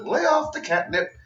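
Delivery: high, haughty put-down, upright and cool; lightly voiced Charlotte tossing off a snooty line; imitating Charlotte. Vlogger quotes Charlotte's put-down, imitating her haughty tone. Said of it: "the way that Charlotte said it".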